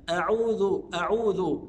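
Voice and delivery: "أعوذ" with tongue tip on the teeth for ذ; tongue-tip dhal. Shown as a demonstration. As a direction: place a voiced th in "أعوذ", firmly. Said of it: "طرف لساني على طرف الأسنان". (نطق "أعوذ" بطرف اللسان على الأسنان)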